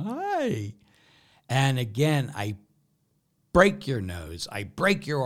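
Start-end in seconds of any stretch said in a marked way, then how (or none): 0.0-0.7 high, drawn-out squeaky "hi"; mock greeting. Priest greets the person he keeps hurting, mock high greeting. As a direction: sing out a very high, fake hello. Acted sheepish greeting to the victim.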